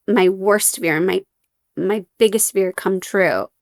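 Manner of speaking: usual speaking voice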